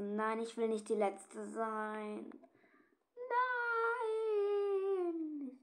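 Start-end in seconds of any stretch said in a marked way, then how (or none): 3.2-5.6 long high crying no; drawn-out anguished wail. Real, not acted. Player realising she is the last one left, anguished wail. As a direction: wail it in despair.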